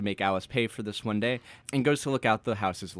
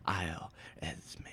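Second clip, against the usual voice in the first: whispered; 4 semitones lower, 10 dB quieter, slower than usual. A narrator's reading. Dreamy reveal of the island.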